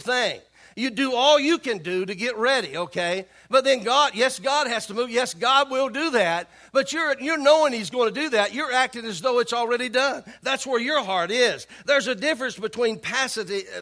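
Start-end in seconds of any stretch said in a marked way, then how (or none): 3.5-10.2 rising preacher's fervour, raised and louder; preaching build. Acted. Pastor rousing the congregation about God moving. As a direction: build the point with fire.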